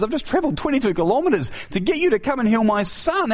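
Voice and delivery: urgent plea. Retold or imitated. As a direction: pile on the man's desperation. Official's desperate plea retold.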